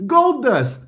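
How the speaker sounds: high, loud comic exclamation; mock high exclamation. Mocking punchline reference.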